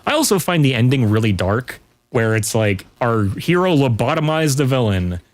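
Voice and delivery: ordinary talk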